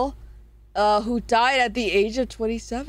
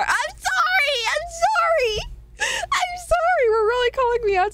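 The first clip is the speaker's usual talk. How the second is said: mock wailing apology. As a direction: wail it like a scolded kid, overdone. Called out by chat, mock wailing.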